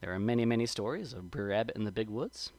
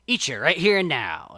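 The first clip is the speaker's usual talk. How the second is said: mild menace building to a louder finish; snarling threat; 3 semitones lower, 6 dB louder than usual. A narrator's reading. Brer Fox threatens his captive Brer Rabbit, mild snarling menace. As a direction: threaten them, loud on the last words. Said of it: "and he snarls".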